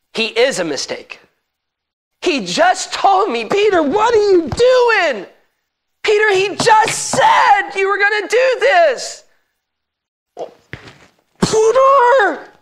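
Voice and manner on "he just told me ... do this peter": high, loud, slow cry of Peter's name; frantic accusing shout. Acted. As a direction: yell it out, panicked and accusing. Preacher acting out frantic accusation.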